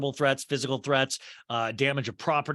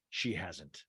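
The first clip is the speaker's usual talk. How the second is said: much lower and softer voice-over; Arrested Development narrator aside; 9 semitones lower, 10 dB quieter than usual. Acted. Repeating the show-style correction of Jen, low narrator aside. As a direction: drop right down for a narrator's quiet correction. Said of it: "that's the Arrested Development".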